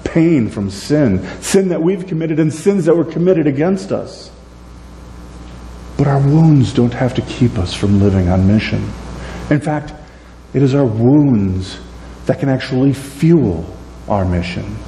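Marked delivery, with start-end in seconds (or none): none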